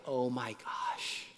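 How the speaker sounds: slow, moved whisper; proud dad whispering to himself; emotional whisper. Retold or imitated. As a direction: breathe it out, overcome with pride. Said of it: "I'm getting emotional thinking about it".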